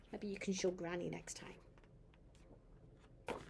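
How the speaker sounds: soft whisper to a grandchild. Real, not acted. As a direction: hush it, just breath. Grandmother whispers aside to child.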